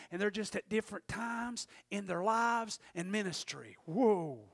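soft, subdued reflection; hushed preaching. Real, not acted. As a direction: bring the congregation in quietly.

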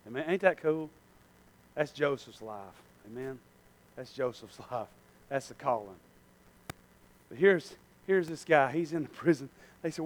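ordinary talk

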